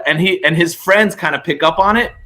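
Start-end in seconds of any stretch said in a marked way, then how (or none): none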